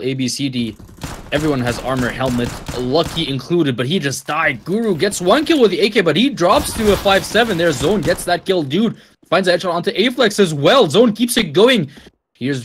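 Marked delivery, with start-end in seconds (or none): 4.6-11.9 esports caster. Real, not acted. Caster calls fast kills.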